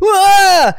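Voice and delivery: mock wail. Sling shot sound mock.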